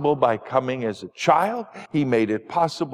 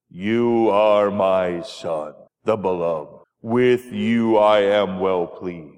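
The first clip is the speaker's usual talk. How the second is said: much lower and slow, voice from heaven; booming voice of God; 8 semitones lower, slower than usual. Pretend. Pastor voicing God from heaven for the children, low and slow. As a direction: boom it down from the clouds like God. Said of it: "that big booming voice".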